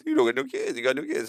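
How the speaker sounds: high-pitched teasing jeer; mock taunting. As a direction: imitate a rival wife needling. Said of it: "who made fun of her".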